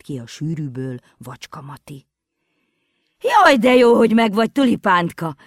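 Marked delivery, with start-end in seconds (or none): 3.2-5.4 loud high call of relief; relieved shout. A narrator's reading. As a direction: shout with joy at finding her. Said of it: "kiáltott".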